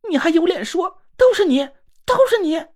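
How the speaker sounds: put-on girl sobbing her accusation; tearful accusation. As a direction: hurl the blame through tears. Crying girl accusing narrator.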